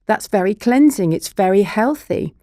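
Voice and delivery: usual speaking voice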